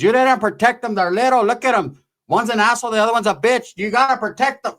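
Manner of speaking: mild mock yell at the cousins; retold yelling aunt. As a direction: imitate an aunt telling kids off. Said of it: "She's yelling at my primos".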